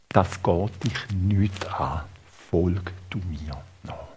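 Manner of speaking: low, hushed, slow rebuff; understated stern reply, almost whispered; quoted brusque answer. Retold or imitated. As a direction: shut the question down quietly and slowly. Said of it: "eine ganz schroffe Antwort".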